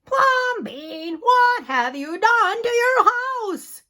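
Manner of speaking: high, loud, slow anguished shout; shouting neighbors. Acted. Neighbors shouting at Plumbean over his house, loud and high. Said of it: "the people shouted".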